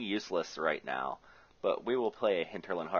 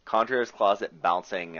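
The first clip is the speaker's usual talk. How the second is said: ordinary delivery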